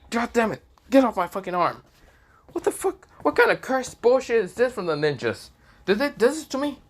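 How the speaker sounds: high, strained yelling at the stuck gauntlet; furious yell. Acted. Issei yelling at the gauntlet on his arm, high and furious. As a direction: shout it, panicking and furious.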